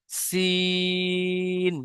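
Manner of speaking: সিন with a thin hissing s, drawn very long; thin long sin. Shown as a demonstration. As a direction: hiss সিন like a pressure cooker whistle. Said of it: "তিন আলিফ টান দিয়ে পড়তে হবে". (পাতলা আওয়াজে লম্বা টেনে সিন)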